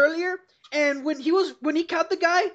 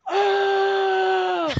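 angry yell; 5 semitones higher than usual. Retold or imitated. Coworker yells at himself for letting the thief go. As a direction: let out one angry "Ah!" at himself. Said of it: "all they hear is a yell going".

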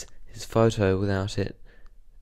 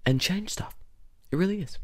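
whispered; 8 semitones higher than usual. Real, not acted. Earnest point about rewilding.

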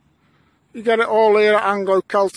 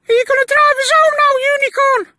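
fast squeaky falsetto, much higher; silly high voice; 4 dB louder, faster than usual. Pretend. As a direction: chatter like a cartoon unicorn. Squeaky unicorn voices exchanged.

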